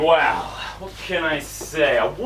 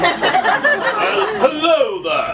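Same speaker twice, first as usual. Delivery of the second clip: high, drawn-out charmer's greeting; exaggerated coaching demo, pitched up; demonstrated put-on voice; 10 semitones higher, much slower than usual. Shown as a demonstration. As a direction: model a slow, swooping suave hello. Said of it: "try speaking in a voice like this".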